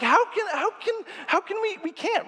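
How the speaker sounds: panicked Israelites. Retold or imitated. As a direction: squeak it out in helpless panic. Terrified Israelites voiced.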